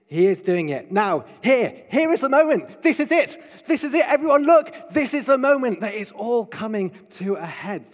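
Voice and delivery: building to a high, loud cry, then easing; mounting excitement. Acted. Preacher announcing the moment of fulfilment to everyone, excited shouting. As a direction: whip it up as if summoning everyone to look.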